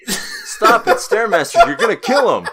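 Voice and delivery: high, loud, laughing plea. Real, not acted. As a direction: crack up while pleading. Laughing at the prospector bit.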